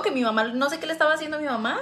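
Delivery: ordinary talk, nothing marked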